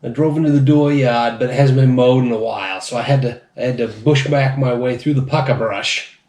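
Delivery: loud hammed-up Maine accent. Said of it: "bad Maine and New England and Boston accents".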